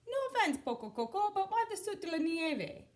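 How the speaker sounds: high amused puzzled question; quizzical dog voice. Acted. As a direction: tease him, all curious.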